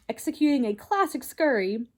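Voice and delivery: usual speaking voice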